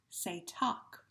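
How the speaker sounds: normal talking voice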